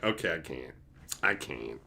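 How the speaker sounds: low, soft resigned giving up. Retold or imitated. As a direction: drop it, defeated. Giving up sharing with friends.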